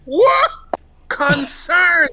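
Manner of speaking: high, loud shocked cry; high mock shock; shocked mother. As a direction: gasp in disbelief.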